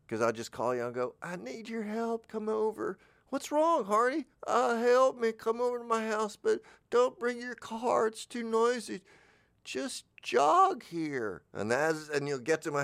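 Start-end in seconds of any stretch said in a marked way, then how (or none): 1.2-3.0 very high, put-on helpless voice; badly faked plea from a sickbed; faking sick. Coach faking sick on the phone, high and needy. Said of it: "I faked being sick". 3.3-4.3 very high mock-worried voice; concerned friend. Friend answering a call for help, high mock concern. 4.5-11.4 very high, drawn-out helpless plea; badly faked feeble invalid, pitched way up; faking sick. Coach luring a friend over, mock-helpless high voice. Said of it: "I faked being sick".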